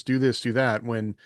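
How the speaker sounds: normal talking voice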